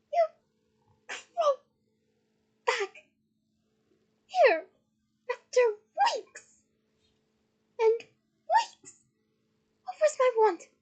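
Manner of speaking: high, loud, word-by-word fury; furious tirade. A narrator's reading. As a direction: spit out each word with a gap between. Hermione raging at returned Ron.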